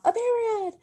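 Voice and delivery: high, quick mock call-out of the answer; mock kid blurting out. Shown as a demonstration. Teacher mimicking a student blurting the answer. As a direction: play a kid shouting the answer without thinking. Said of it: "rather than just yelling out".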